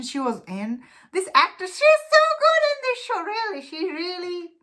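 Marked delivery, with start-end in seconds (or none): 1.1-4.5 very high, excited gush; real burst of admiration, pitch soaring; gushing praise. Real, not acted. Reviewer praises the lead actress to viewers, gushing.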